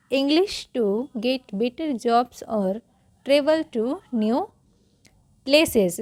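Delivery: word by word, slowly, for repetition; deliberate model reading; slow read-along. Shown as a demonstration. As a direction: read it slowly with gaps between words. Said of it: "चलिए repeat करते हैं".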